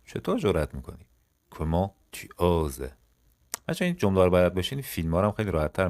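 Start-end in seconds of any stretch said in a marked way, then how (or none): 1.5-2.9 each syllable drawn out slowly for learners; slow model phrase. Shown as a demonstration. French teacher to students, slow model read. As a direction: say it slowly and clearly.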